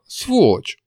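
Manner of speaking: high, mildly tearful outburst; sobbing insult. A narrator's reading. Demon turning away from the hero, mildly tearful. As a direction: throw the insult with a hint of tears. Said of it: "вдруг всхлипнула".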